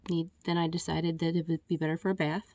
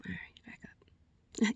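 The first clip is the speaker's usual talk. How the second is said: mock whisper; 4 semitones higher, 10 dB quieter, slower than usual. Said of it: "And then they're like".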